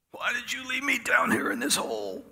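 high anguished plea to God; desperate high cry to heaven. Retold or imitated. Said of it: "cried out to the Lord".

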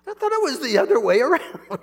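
loud, high, amused answer with a laugh; laughing reply. Retold or imitated. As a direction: tease it back through a laugh. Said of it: "He goes".

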